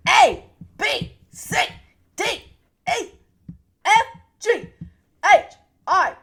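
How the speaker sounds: peppy cheerleader chant. Acted. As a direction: cheer the alphabet like a pep rally. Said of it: "God, I sound like a cheerleader".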